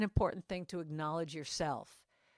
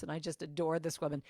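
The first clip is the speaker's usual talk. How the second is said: ordinary delivery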